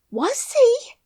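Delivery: very high loud startled cry; astonished Yorkshire maid. A narrator's reading. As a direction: shriek your disbelief. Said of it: "cried Martha".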